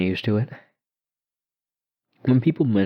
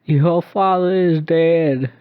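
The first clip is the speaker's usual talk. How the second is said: put-on slow, high, louder eerie child voice; ghost girl insisting; 10 semitones higher, 7 dB louder, much slower than usual. A narrator's reading. Ghost girl repeating that his father is dead, slow and eerie. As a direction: drag it out to unsettle him. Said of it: "she said again".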